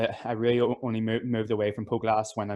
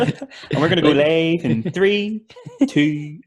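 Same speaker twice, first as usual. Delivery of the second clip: higher, louder, slow countdown, hamming it up; mock live countdown; 5 semitones higher, 6 dB louder, slower than usual. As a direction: announce going live with a grin, drawing out three. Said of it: "copying your podcast".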